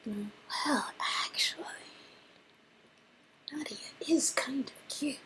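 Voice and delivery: soft confiding whisper. Acted. Confesses her crush on Nadia in a whisper. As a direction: confess it quietly, half hidden.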